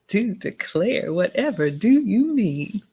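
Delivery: slow Southern belle drawl; Southern accent. Pretend. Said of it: "Warm up that Southern accent for us".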